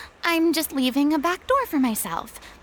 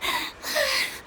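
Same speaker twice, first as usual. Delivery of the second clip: a high, drained sigh of embarrassment; mortified sigh; 11 semitones higher, 5 dB quieter than usual. Acted. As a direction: let the shame out in one sigh. After dying of embarrassment.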